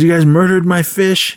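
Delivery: high, aggrieved imitated rant; mock angry yell. Fed-up teacher lists his sacrifices, mock yelling. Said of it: "he was just yelling at all of them".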